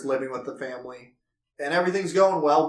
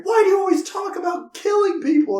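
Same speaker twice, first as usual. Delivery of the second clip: much higher, panicky imitated niece; freaked out; 3 dB louder than usual. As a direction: squeal it like a frightened girl. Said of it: "like, freaks out".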